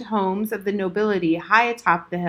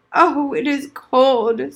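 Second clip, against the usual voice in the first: put-on old veteran remembering; 4 semitones higher, 6 dB louder, slower than usual. Old soldier complains.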